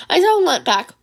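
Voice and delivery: ordinary delivery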